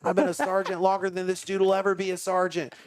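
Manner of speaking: high-pitched indignant boast, no shouting; worked-up, mock-furious comeback; retold angry outburst. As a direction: act out mouthing off at a cop. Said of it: "I'm so mad".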